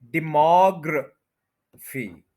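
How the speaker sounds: Demography drawn out slowly, MOG stressed, short ra; a slow classroom model of demography; slow stress demo. Shown as a demonstration. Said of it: "इसका MOG पे stress है".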